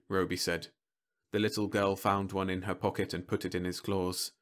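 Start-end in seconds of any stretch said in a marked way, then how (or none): none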